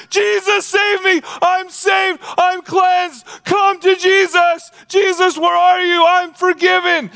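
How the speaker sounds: very high, ecstatic yell; shouting praise. Acted. Healed leper running back to Jesus, shouting praise. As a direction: shout it for the whole world to hear. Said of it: "He's shouting".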